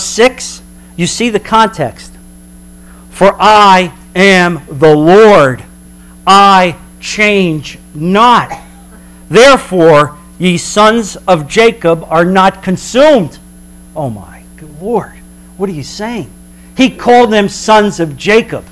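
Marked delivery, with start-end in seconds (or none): 3.1-13.4 very slow, solemn declaration; God declaring He changes not, slow and solemn. Acted. Scripture voiced as God. 13.9-16.3 awed hush. Real, not acted. Awed aside to congregation.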